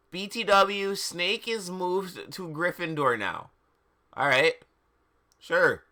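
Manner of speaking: ordinary talk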